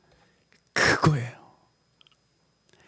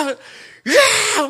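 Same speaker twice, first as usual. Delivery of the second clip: anguished yell; 11 semitones higher, 3 dB louder, much slower than usual. Retold or imitated. Army morning run agony.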